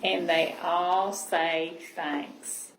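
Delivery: slow, lingering final line; slow closing line of a bedtime read; slow storybook ending. Acted. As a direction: draw out the last line of the book. Reading picture book aloud.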